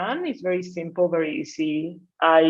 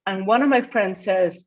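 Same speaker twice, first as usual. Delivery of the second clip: normal talking voice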